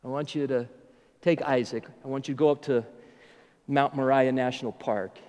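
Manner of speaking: low, soft, halting delivery as God; quiet and measured, pauses between phrases; grave divine instruction. Retold or imitated. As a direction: imitate God laying out a hard request, piece by piece. God gives Abraham the grave command.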